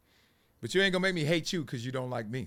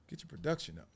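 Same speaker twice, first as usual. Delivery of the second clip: quiet scolding aside; 10 dB quieter, faster than usual. Retold or imitated. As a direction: mutter a note to the producer. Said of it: "Stop, Marcellus".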